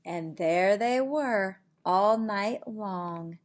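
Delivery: slow, hushed bedtime storytelling; sleepy bedtime reading. Acted. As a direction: lull them toward sleep. Bedtime ending of the book.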